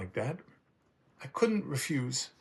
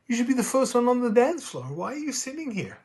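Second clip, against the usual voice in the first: put-on high, startled young man's voice; a young man amazed the grandfather isn't dancing; surprised young man; 12 semitones higher than usual. Young man to the old grandfather, pitched high in surprise.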